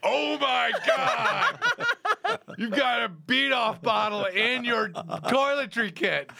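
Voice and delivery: mock outrage. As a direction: ham up a friend's shocked outrage. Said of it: "Kevin's like".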